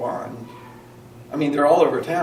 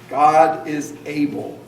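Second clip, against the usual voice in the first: slow, louder "God is able"; emphatic lead line; 5 dB louder, slower than usual. Shown as a demonstration. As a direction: say it slow for them to echo. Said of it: "Can you say that with me?".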